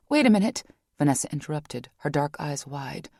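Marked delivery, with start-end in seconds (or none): 0.1-0.6 higher, louder urgent interruption; a young woman jumping in alarmed. A narrator's reading. Vanessa interrupts.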